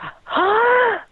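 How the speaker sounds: high sharp reenacted gasp for air. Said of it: "so I took in a breath".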